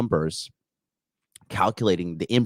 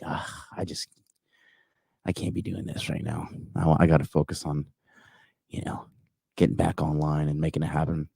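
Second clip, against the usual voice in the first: softer, half-whispered, worn out after a late night; tired groan; 2 semitones lower, 5 dB quieter than usual. Retold or imitated. Streamer after getting home at four, tired mutter. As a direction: mutter it like you're hungover. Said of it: "I was just like".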